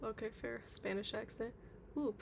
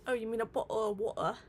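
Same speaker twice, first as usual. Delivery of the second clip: louder, clipped-T British bottle of water; British accent; 6 dB louder than usual. Shown as a demonstration. Streamer answering a chat request in a put-on British accent. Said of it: "Bottle of water in British".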